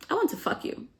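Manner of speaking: high, soft, playful proposition; mock blunt come-on. Blunt proposition in retold scene.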